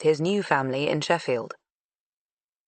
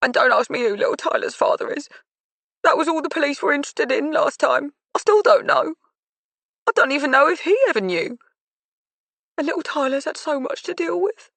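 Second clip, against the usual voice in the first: much higher, louder, near breaking; distraught; 11 semitones higher, 4 dB louder than usual. Acted. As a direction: grief spilling into anger. Mother's grief turns bitter.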